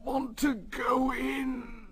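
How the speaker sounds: very high, slow, crying plea; frightened engine. A narrator's reading. Henry begging his driver not to go in, near tears. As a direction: wail it like a scared engine.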